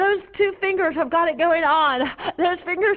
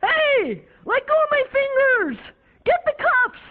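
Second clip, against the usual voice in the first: higher, alarmed officer yelling; officer shouting; 8 semitones higher than usual. Acted. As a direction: yell for backup in alarm. Officer shouts as she grabs.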